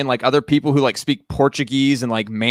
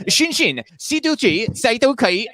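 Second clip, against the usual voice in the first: higher, sing-song mock Chinese phrases; 9 semitones higher than usual. Podcaster imitating the guy speaking Chinese to vendors, mock Chinese. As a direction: rattle off pretend Chinese in a raised voice. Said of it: "And then he's like".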